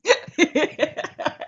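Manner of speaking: high laugh bursting out; a real laugh; burst of laughter. Real, not acted. Laughing at funeral joke.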